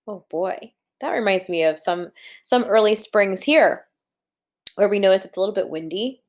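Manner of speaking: ordinary delivery, nothing marked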